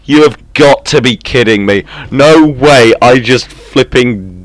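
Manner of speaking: high, loud, can't-believe-it; excited disbelief. Real, not acted. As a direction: shout in gleeful disbelief. Disbelief at safe exploding.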